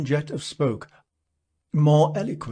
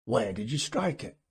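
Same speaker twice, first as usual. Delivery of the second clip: plain reading voice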